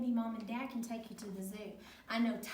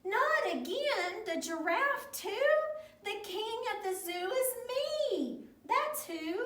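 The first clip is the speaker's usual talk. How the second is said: high, indignant storybook king; hammed-up stubborn boast for children; stubborn protest; 10 semitones higher, 3 dB louder than usual. A narrator's reading. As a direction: dig in and insist, exasperated. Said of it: "stretched his neck out stubbornly".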